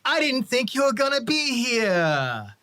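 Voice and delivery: drawn-out, high delighted call of a name; surprised greeting. Acted. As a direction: greet him like an unexpected old friend. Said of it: "George walks over and goes".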